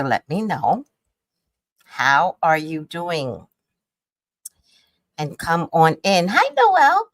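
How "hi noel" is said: high, loud sing-song hello; excited greeting. Real, not acted. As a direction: call out a bright welcome. Greeting viewer on livestream.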